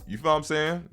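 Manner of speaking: high, sassy check-in; zesty. Real, not acted. Creator checking that viewers follow, zesty. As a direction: toss it out high with some zest. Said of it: "That was kinda zesty".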